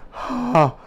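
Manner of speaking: high, loud re-enacted gasp; shocked gasp. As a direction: suck in air as if horrified. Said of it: "That was my face when it happened".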